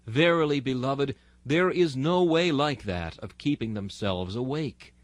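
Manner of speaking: ordinary talk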